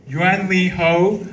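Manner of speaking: ordinary talk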